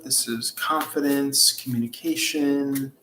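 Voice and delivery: ordinary talk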